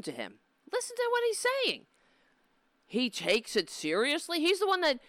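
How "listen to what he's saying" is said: high fast exasperated cry; urging listeners in disbelief; exasperated outburst. Real, not acted. Host urging audience to hear senator, exasperated.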